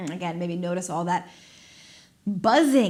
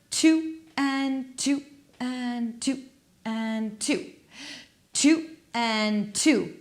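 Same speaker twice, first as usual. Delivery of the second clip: slow counting; 3 semitones higher, much slower than usual. Real, not acted. Slow counting cue for tapping.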